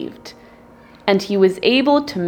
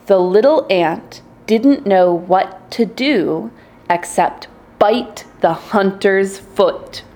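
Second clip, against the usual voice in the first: plain reading voice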